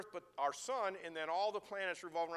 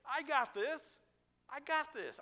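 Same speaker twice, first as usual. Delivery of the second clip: high, emphatic reassurance; preacher voicing God's promise; God reassuring; 11 semitones higher, 5 dB louder than usual. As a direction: announce it confidently on behalf of God. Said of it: "That's what God's saying".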